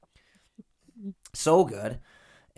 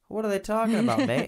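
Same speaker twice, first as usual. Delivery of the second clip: a high, loud jokey Aussie voice, badly done; mock Australian viewer, hammed-up Aussie accent; 12 semitones higher, 4 dB louder than usual. Imitating a puzzled Australian viewer, bad Australian accent. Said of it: "That's not a very good Australian accent".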